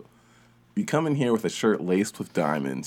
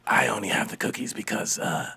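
whispering; 3 semitones lower, 5 dB quieter than usual. Acted. Excuse given in a whisper.

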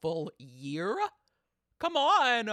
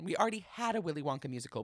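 ordinary delivery

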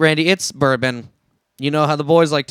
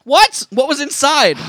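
shocked, much higher and louder; alarmed; 10 semitones higher, 5 dB louder, slower than usual. Acted. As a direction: gasp it out in alarm. Alarmed reply to spilled pouch.

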